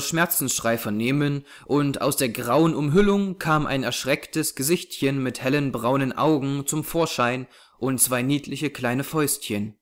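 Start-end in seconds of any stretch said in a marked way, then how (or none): none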